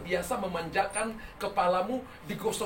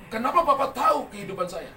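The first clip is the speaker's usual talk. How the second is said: raised, louder incredulous asking; retold astonished question; 5 semitones higher, 7 dB louder than usual. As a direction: act out her amazement, pitch up. Woman amazed he knows her life. (menirukan pertanyaan penuh keheranan)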